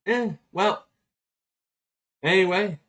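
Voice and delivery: lightly voiced archangel brushing it off; breezy dismissal. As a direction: toss it off casually. Voicing the Archangel shrugging it off.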